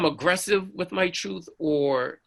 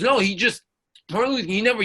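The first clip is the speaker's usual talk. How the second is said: high, stumbling retort; flustered protest; 8 semitones higher, 4 dB louder, faster than usual. Retold or imitated. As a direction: imitate a sergeant insisting he is right. Sergeant arguing back, retold.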